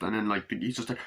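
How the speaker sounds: ordinary talk, nothing marked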